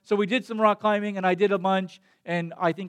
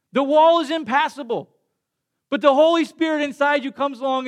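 louder, higher preaching voice; preaching at full force; 7 semitones higher, 5 dB louder than usual. Acted. Pastor pressing the point on the congregation, preaching at full force. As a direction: drive the point home to the room.

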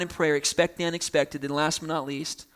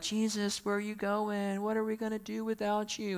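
whining disciples; 3 semitones higher, 10 dB quieter than usual. Retold or imitated. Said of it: "disciples were whining".

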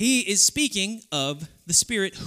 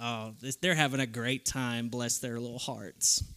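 fondly condescending; 5 semitones lower, 5 dB quieter than usual. Retold or imitated. Fond mock pity.

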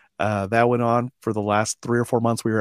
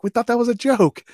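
higher-pitched mock disbelief; incredulous retort; 12 semitones higher, 4 dB louder than usual. Soldiers reacting to real communion wine, incredulous. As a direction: quote the disbelief with a high lift.

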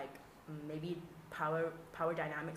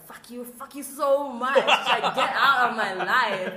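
loud, slow, high mock fury; hammed-up cursing outburst, dragging each word; inner rage; 7 semitones higher, 16 dB louder, much slower than usual. Host's silent thoughts at late friend, mock raging. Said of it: "in my head, I'm like".

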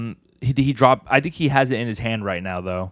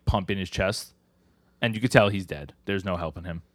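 ordinary delivery

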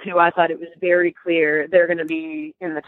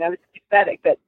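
ordinary talk

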